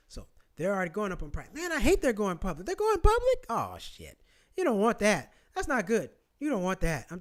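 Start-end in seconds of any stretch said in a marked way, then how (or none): 2.6-4.2 mock surprise. Mock shock at the IPO news.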